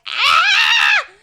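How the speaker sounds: playful fake tantrum yell on ah; mock Aries rage scream. Jokingly playing a furious Aries for her chat, mock screaming. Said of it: "I find it cute to be like".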